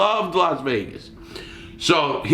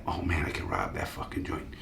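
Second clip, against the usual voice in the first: very low, quiet aside to himself; hushed inner thought; 11 semitones lower, 13 dB quieter than usual. Retold or imitated. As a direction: let the tempting thought slip out under your breath. Voicing a secret tempted thought.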